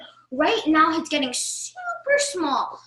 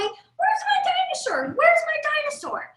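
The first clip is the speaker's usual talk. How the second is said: crying yell; 13 semitones higher than usual. Acted. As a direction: wail like a kid who lost his dinosaur. Child upset over lost toy.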